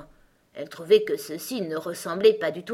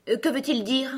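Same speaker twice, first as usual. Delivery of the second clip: higher-pitched impatient question; 7 semitones higher, 3 dB louder than usual. A narrator's reading. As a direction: demand an answer, a bit testily. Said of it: "d'un ton impatienté". (question impatientée)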